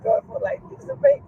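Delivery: high, choked-up voice through tears and laughs; high, wavering tearful voice; tearful, crying while talking. Real, not acted. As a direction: overcome with happy tears, voice cracking high. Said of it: "Mama always crying".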